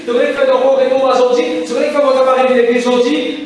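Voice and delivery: loud, driving sermon cadence; hammering call and response to the church; fiery preaching. Acted. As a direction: preach it out to the congregation. Preaching at full force.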